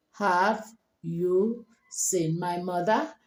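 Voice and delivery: slow, spaced-out "Have you seen my mother", rising on "mother"; words separated, rising. Shown as a demonstration. Teacher breaking the question down, slow words rising on "mother". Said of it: "You bring them together".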